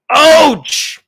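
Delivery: sharp loud yelp of hurt; comic cry of pain; pained ouch. Real, not acted. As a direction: yelp it like you just got hit. Reacting to a jab.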